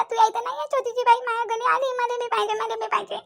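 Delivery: put-on high-pitched childish wail of demand; tearful whining. Child begging mother for a baby sister, high tearful whine. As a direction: whine and plead through tears. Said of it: "असं रडत नसते".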